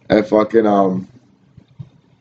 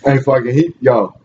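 ordinary talk

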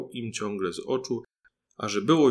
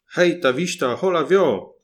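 loud, higher shouts urging the horses on; a boy driving horses, shouting commands; calling to horses; 6 semitones higher, 8 dB louder than usual. Acted. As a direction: call out to the team like a little coachman. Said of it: "A malec wołał".

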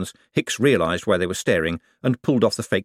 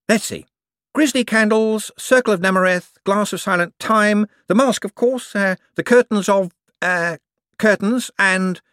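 high, eager, bright-voiced wizard; a jumpy official covering for himself; 13 semitones higher, 5 dB louder than usual. A narrator's reading. Hicks listing props.

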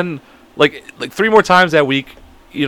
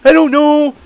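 loud, high goofy answer; over-the-top put-on character; 12 semitones higher, 5 dB louder, slower than usual. Answering her while keeping up the act, high put-on voice. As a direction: answer in the pitched-up pretend voice. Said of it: "I would just go straight into it".

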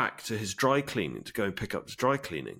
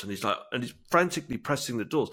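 ordinary delivery

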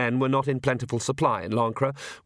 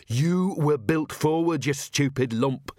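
exasperated inner scream; 4 semitones higher, slower than usual. A narrator's reading. Inner voice scolding Agnes, understated exasperated scream. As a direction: drag out the insult like a muted scream. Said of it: "Perdita screamed".

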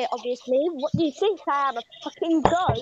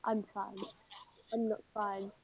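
faint, worn out voice; weak quiet; 8 semitones lower, 14 dB quieter than usual. Real, not acted. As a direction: say it faintly after coughing. Quiet after coughing fit.

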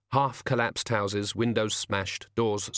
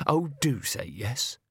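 slow, drawn-out eager plea; coaxing villain; 3 semitones higher, slower than usual. Acted. Henchman urging the coachman, slowly and sweetly. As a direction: coax him sweetly.

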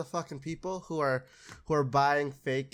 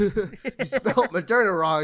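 slow, much higher, loud accusing repeat of "You spelt Moderna wrong"; mock stern official; 7 semitones higher, 10 dB louder, slower than usual. Acted official, repeated for effect.

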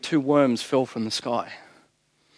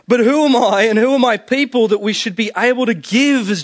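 raised, higher and louder, proclaiming the prayer; fervent scripture reading; 6 semitones higher, 5 dB louder than usual. Acted. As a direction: call it out like a proclamation to the church. Preacher reading Bible prayer aloud.

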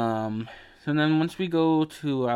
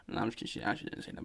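hushed aside; 4 semitones lower, 12 dB quieter, much faster than usual. Real, not acted. Quick aside retracting a joke.

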